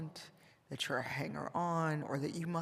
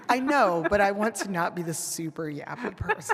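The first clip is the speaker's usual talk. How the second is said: loud, higher, through laughter; laughing protest; 4 semitones higher, 10 dB louder than usual. Real, not acted. Panelist defending not wanting to be the yappy one, laughing protest.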